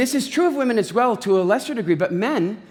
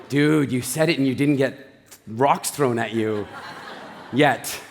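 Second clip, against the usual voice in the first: lower, laughing dude-to-dude voice; bushman dude; 8 semitones lower than usual. Retold or imitated. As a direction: tease your buddy with a chuckle, lower. Men voiced in bushman camp.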